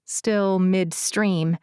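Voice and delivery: usual speaking voice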